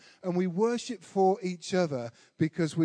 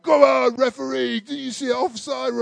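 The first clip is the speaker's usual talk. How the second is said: loud, higher football-crowd yell; crowd shouting; 7 semitones higher, 8 dB louder than usual. Retold or imitated. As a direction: bellow it like the whole stand at the referee. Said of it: "Twenty-five thousand other people are like".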